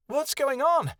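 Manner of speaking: very high, loud yell; high-pitched panicked character yell. A narrator's reading. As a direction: yell out in alarm. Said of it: "Kia yelled".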